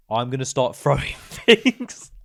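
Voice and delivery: slow, loud threat broken by laughter. Retold or imitated. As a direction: threaten it slowly through a laugh. Uncle threatening staff.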